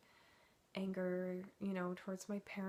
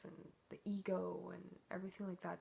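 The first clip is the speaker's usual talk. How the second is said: normal talking voice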